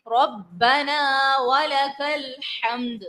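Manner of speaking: slow drawn ربنا with doubled ba; slow chanted recitation; tajweed recitation. Shown as a demonstration. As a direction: recite slowly, stressing the shadda.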